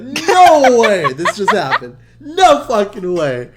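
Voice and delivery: high, drawn-out incredulous yell; loud disbelief. Retold or imitated. Retelling getting caught by his mom, loud disbelief. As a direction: yell it slowly, a laugh creeping in. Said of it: "I'm saying this shit out loud".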